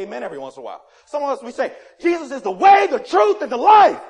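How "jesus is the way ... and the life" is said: high, very loud proclamation; full preaching shout. Acted. Preacher proclaiming gospel to rouse an amen, shouting at full force. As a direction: thunder each phrase out to the congregation.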